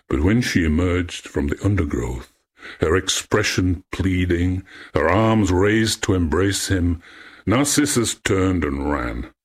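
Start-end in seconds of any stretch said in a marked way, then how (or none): none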